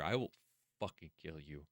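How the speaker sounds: very low, hushed menace; quiet threat. Real, not acted. Streamer threatens a fictional character, quiet menace. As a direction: drop right down to a soft threat.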